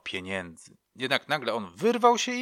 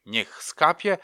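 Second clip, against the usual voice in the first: louder, higher ritual chant with a rise at the end; solemn mother's loud ritual call, lifting on „skapie”; incantation; 6 semitones higher, 7 dB louder, slower than usual. Retold or imitated. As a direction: intone it like a spell over the candle. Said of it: "mówiła".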